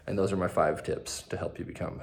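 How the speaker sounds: ordinary delivery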